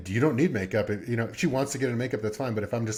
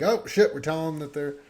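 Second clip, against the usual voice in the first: high loud startled exclamation; sudden alarm; 8 semitones higher, 5 dB louder than usual. Retold or imitated. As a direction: catch yourself in sudden alarm. Parent catching mistake.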